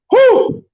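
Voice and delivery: loud high whoo yell; whoop. Real, not acted. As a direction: let out a big high whoo. Exclaims after the story.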